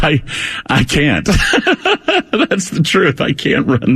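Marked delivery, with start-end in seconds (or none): 1.3-2.4 a sudden, real, high laugh; high burst of laughter. Real, not acted. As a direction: crack up at yourself. Laughing at his own running.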